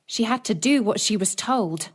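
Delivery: ordinary delivery, nothing marked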